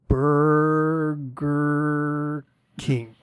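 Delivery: syllable by syllable, each one stretched right out; very slow pronunciation. Retold or imitated. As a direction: draw every sound out for a slow learner. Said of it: "Pronounce it very slowly".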